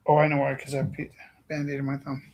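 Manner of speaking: normal talking voice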